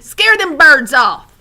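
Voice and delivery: put-on high, loud, slow old woman's call. A narrator's reading. Old woman urges the rabbit scarecrow, high loud call. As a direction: holler it at the scarecrow.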